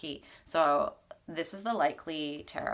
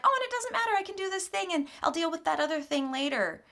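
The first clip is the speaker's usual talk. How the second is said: high, bright brush-off of a worry; careless inner voice; 13 semitones higher, 4 dB louder, faster than usual. Retold or imitated. Mimicking people who put their values aside, high and breezy. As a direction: toss it off like nothing matters. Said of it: "they're just like".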